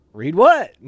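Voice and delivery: loud, high puzzled question; confused read what. Retold or imitated. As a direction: act dumb about the letter. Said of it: "I was just like".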